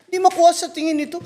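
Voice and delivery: usual speaking voice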